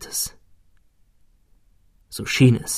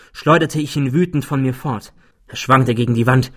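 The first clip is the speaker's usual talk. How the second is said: plain reading voice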